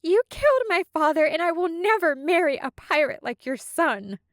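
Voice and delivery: high, fast, shaking defiance; trembling voice. Acted. Soraya defying Kelsey over her father's death, trembling and high. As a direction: hold back tears while defying him. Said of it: "her voice trembling like shaken earth".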